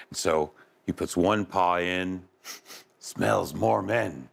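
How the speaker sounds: usual speaking voice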